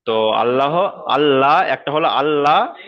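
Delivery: আল্লাহ with a round-mouthed heavy L; full heavy আল্লাহ; heavy rounded Allah. Shown as a demonstration. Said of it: "মুখটা গোল করে পড়তে হয়".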